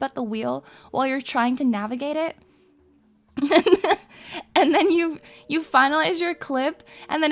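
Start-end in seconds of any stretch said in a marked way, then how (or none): 3.4-4.0 short loud high laugh; burst of laughter. Real, not acted. Laugh while ranting.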